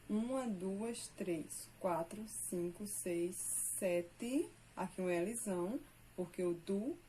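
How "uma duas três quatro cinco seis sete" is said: counting syllables slowly, one by one; counting metrical syllables aloud. Shown as a demonstration. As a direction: tick off each syllable for the class. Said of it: "Observe aqui".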